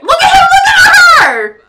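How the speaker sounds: high, loud laughing shriek; excited shriek. Real, not acted. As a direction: squeal with delight. Excited squealing at game.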